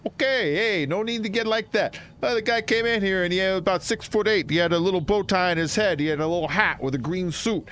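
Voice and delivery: high-pitched New York dock worker; New Yorker. Acted. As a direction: play an innocent witness in a New York accent. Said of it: "I talk like a New Yorker".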